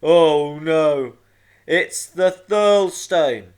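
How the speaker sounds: loud, slow giant in alarm; big, drawn-out rumbling cry; rumbling dismay. A narrator's reading. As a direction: boom it out slowly, rising in dread. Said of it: "he rumbled".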